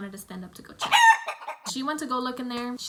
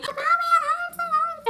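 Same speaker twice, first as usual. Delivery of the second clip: put-on very high, loud child's wail; imitated crying; 7 dB louder than usual. Crying girl telling mom about the bite, mock high wail. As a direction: mimic a little girl bawling in pain. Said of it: "And the girl's just crying".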